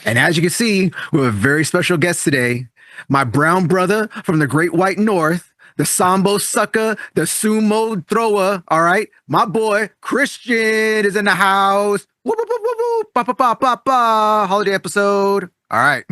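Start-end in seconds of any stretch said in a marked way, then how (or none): 3.1-12.1 slow, higher hype-man announcing; drawn-out announcer voice introducing a special guest; hype man intro. Acted. 12.2-13.1 very high, light whooping; celebratory whoops. Acted. Celebrating guest arrival. 13.1-14.5 higher sung ba-ba fanfare; playful vocal horn riff. Acted. Fanfare after intro.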